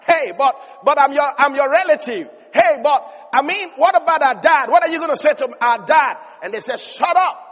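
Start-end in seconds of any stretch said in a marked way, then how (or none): 0.0-6.2 louder, higher desperate calls; shouting plea. Retold or imitated. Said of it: "And he's shouting". 6.8-7.5 higher, louder snap; sharp retort. Retold or imitated. Brothers snap back.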